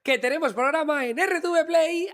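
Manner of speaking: hoarse voice cracking high and loud. Real, not acted. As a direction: push a worn-out voice until it cracks. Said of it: "no tengo voz".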